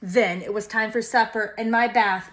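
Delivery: plain reading voice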